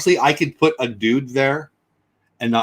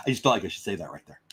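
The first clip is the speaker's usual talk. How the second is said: ordinary talk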